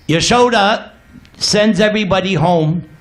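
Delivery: ordinary talk, nothing marked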